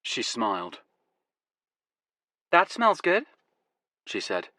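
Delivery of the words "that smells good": put-on higher young American woman, light accent. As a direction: play her as an American, friendly. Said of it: "She had an American accent".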